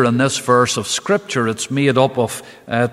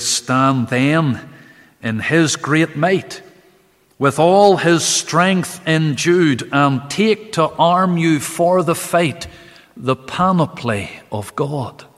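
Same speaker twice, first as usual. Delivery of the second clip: slow, raised reading of hymn verse; hymn recitation; 4 semitones higher, slower than usual. Acted. Preacher reciting the hymn's second verse, slow and raised. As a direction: intone each hymn line with weight.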